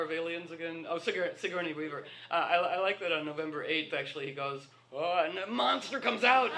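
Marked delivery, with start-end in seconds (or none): 4.9-6.5 higher, louder and slow; mock dramatic reveal. Telling the room how the monster leaves Trump, hammed-up. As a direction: announce the monster like a horror trailer.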